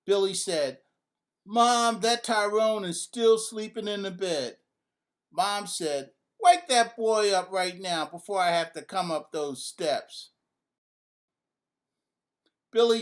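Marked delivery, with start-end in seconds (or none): 1.4-4.6 raised high tattling call; calling to mom. A narrator's reading. Little brother calling down to mom, raised and high. Boy telling on his brother. 6.4-10.3 stern mom call. A narrator's reading. Mother threatening from downstairs.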